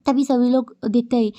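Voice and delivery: plain reading voice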